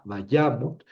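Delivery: vayamot accented on the middle YA; va-YA-mot, unstressed final syllable; stress on YA. Shown as a demonstration. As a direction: put the weight on YA in vayamot. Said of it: "el acento cae acá".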